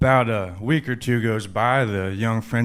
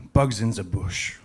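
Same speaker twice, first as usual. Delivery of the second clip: put-on "the" said as "zuh" in a French accent; French-accented delivery of a joke character. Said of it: "The young French lady says".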